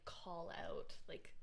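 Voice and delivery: ordinary delivery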